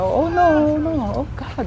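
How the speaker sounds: high, loud cry of alarm, retold; imitated onlooker's shout. People watching a couple nearly run over, imitated shout. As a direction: cry out like a horrified bystander. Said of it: "sigaw yung tao".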